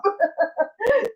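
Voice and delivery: high, embarrassed little laugh; nervous giggle. Real, not acted. To her viewers before singing, a shy high giggle.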